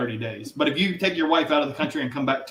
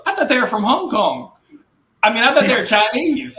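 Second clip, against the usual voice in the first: higher, louder wife's surprise; wide-eyed quoted disbelief, pitched up; wife retelling her mix-up, higher and louder; quoting wife; 10 semitones higher, 7 dB louder than usual. Retold or imitated. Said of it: "She goes".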